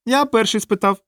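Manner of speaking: high-pitched, reproachful little clown; dejected, high clown reproach. A narrator's reading. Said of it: "докірливо сказав клоун".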